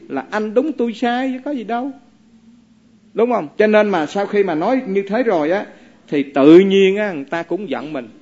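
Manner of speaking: ordinary talk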